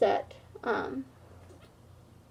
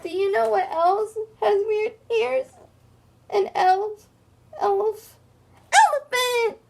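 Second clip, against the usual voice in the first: sobbing, much higher, bursting out on elephant; crying; 10 semitones higher than usual. Acted. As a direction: blubber it out. Crying hippo answers bully.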